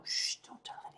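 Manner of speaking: hushed secret whisper; conspiratorial whisper. Retold or imitated. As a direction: whisper it so no one hears. Secret between friends.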